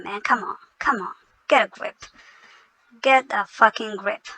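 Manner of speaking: ordinary delivery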